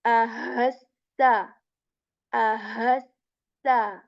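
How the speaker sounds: ahassa said slowly with a breathy ha; slow, push air on the ha in ahassa; ahassa with airy ha. Shown as a demonstration. Teacher contrasting the airy word with Ahmad. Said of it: "hope you can hear the difference".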